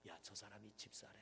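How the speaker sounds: low, soft murmur about someone; acted low-voiced tattling; hushed gossip. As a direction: pass it on quietly, like church gossip. Church members gossiping about each other.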